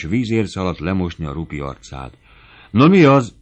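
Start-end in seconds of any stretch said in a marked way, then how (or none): 2.7-3.3 high, loud startled question; sudden, raised-voice surprise. A narrator's reading. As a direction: jolt upright in alarm. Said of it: "riadtak föl a táncosok".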